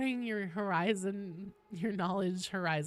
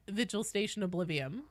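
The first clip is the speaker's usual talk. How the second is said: usual speaking voice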